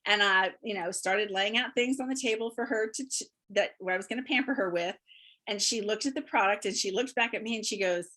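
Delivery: ordinary delivery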